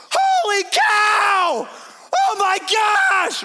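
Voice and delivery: huge high, drawn-out shout of amazement; freaking out. Retold or imitated. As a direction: act totally blown away, yelling each word long. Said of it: "So he's freaking out".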